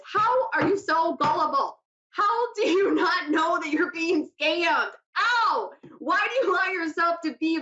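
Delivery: high-pitched, baffled ranting; exasperated disbelief. Real, not acted. Host baffled by gullible scam victims, high exasperated disbelief. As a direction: demand to know how, incredulous and high.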